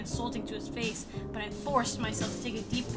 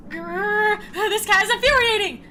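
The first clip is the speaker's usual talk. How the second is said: loud growl into higher complaint; hammed-up frustrated outburst; exasperated; 4 semitones higher, 6 dB louder than usual. Reading the exasperated character's line.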